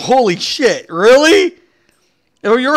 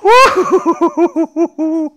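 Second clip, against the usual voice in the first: ordinary talk, nothing marked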